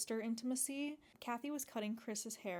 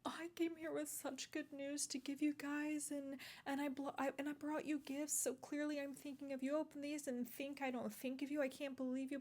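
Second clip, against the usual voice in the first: higher, lightly voiced weepy mother; mock sobbing mother; 5 semitones higher than usual. Vlogger imitating the sobbing mother pleading with her kids, mild mock sobs. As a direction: imitate the mother's tearful guilt trip, half-hearted. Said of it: "breaks down and starts sobbing".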